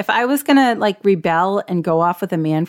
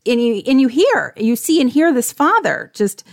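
ordinary delivery

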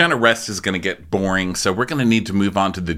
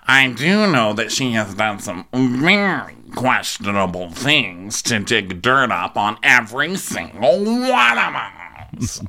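very slow, stretched mock drawl; Meredith; slower than usual. Mimicking Meredith on questionable things, slow affected drawl. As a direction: drag out key words, affected and overdone.

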